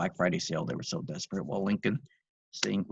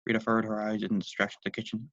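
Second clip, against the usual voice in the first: plain reading voice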